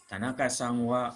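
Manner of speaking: careful, clear textbook reading of the example; model sentence read. Shown as a demonstration. Teacher reading the example sentence to students, careful model reading. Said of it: "Contoh kalimatnya".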